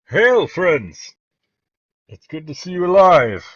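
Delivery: loud, high hail to friends; Jarrett calling out. Acted. Jarrett greets returning friends from the parapet, calling out loudly. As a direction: shout a hearty greeting from a distance. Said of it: "you hear a familiar voice say".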